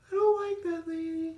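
put-on high little girl voice; wary small girl. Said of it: "wanted me to be the little girl".

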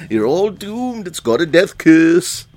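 high ominous warning, echoing the line; doom-laden old man prophecy; Crazy Ralph voice. Retold or imitated. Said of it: "You gotta do the line".

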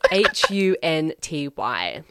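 slow letter-by-letter correct spelling of hunty. Shown as a demonstration. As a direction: spell it out slowly, one letter at a time. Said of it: "that's actually incorrect".

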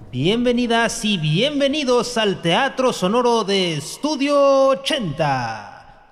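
snake oil seller. Acted. Grand welcome to theater audience.

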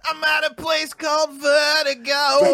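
announcer voice. Pretend. Joke announcer on "[name].

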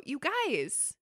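usual speaking voice

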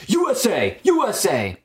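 loud, high chant, hammed up; imitated rowdy shouting; mock patriotic yell. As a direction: chant it like a belligerent drunk from the States. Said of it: "he was, like, yelling".

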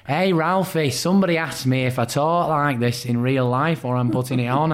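flat northern vowels, Craig's voice; broad flat Mancunian vowels. Retold or imitated. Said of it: "the flat vowels".

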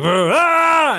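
high, loud scare shout; imitating a haunted house actor's yell; mock chainsaw scare yell. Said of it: "he's like".

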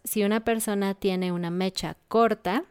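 ordinary delivery

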